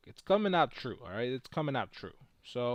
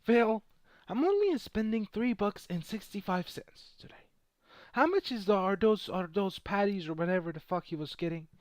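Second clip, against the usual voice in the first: imitating Warren Buffett; 7 semitones higher than usual. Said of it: "He's like".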